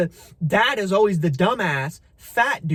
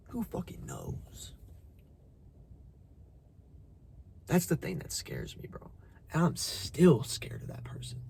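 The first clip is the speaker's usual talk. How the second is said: hushed fear; 2 semitones lower, 11 dB quieter than usual. Real, not acted. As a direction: let the fear show in a near whisper. Confessing fear of his past.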